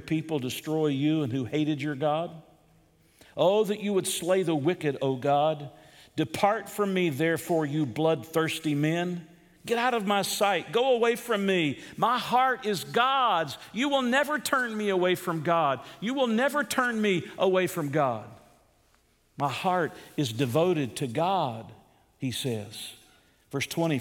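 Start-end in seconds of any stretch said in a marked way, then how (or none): 3.4-5.7 impassioned prayer. Acted. Preacher voicing David's desperate plea, impassioned. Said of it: "Wouldn't you pray that way". 6.1-21.7 high, ringing, defiant prayer; preaching at full force. Acted. Preacher voicing David rebuking bloodthirsty men, fervent.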